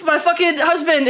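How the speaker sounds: a hint of mock frustration; mock annoyed. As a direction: playfully grumble like she's fed up. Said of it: "she's getting mad".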